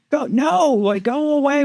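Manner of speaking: high call over the helicopter; waving off a pilot, calling out high; yelling over rotors. Retold or imitated. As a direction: shout it up over the engine noise.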